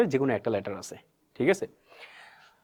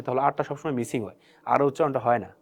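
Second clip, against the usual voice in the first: normal talking voice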